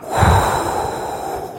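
long breathy exhale; calming sigh. Real, not acted. Podcaster trying to chill out, long calming sigh. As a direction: blow out the tension slowly. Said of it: "I need to just chill out".

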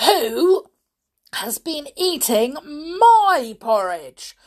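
loud, halting outrage, word by word; angry father bear. A narrator's reading. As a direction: boom out each accusing phrase with pauses. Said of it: "said the father bear angrily".

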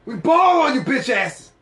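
loud hammed-up rap brag; rapper taunt. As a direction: shout the taunt like a hyped rapper.

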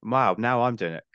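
normal talking voice

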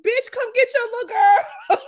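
loud, high, laughing yell at the dad; laughing shout. Retold or imitated. Silently yelling at the daddy, loud laughing shout. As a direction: holler it through tears of laughter. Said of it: "looking at him like".

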